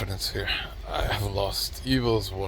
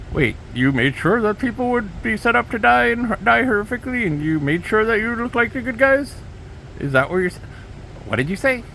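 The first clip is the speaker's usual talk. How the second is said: incredulous; 9 semitones higher than usual. Acted. Incredulous interviewer quote, high pitch.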